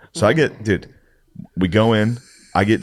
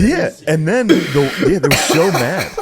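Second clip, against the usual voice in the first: high, slow, laughing through it; can barely get it out for laughing; 8 semitones higher, 3 dB louder, slower than usual. Real, not acted. Telling his friend the story, laughing high and slow.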